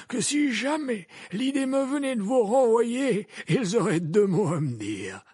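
put-on high, loud old wizard voice; gently probing old headmaster; Dumbledore voice. A narrator's reading.

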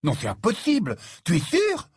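astonished, demand confirmation; stupefied disbelief. A narrator's reading. Ron questions Harry's news, in stunned disbelief. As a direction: pitch leaping up on the closing question. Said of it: "stupéfait".

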